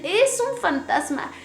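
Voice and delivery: high, slow mock cry of alarm; retold frightened cry. Disciples spotting Jesus on the sea, mildly acted fright. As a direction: imitate frightened men crying out, without shouting. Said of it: "empiezan a gritar y dicen".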